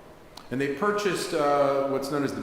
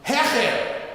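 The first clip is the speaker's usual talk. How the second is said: a sudden shout, much higher and louder; yelling; 10 semitones higher, 5 dB louder than usual. Acted. Old rabbi roaring at the reader to go louder. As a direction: bellow the word from across the room. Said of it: "he yells out at the top of his lungs".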